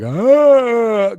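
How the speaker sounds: loud, high, drawn-out "ahh"; gawking yell. Retold or imitated. Imitating Rick standing there staring, high drawn-out gawk. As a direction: hold a high, loud mock gawking cry.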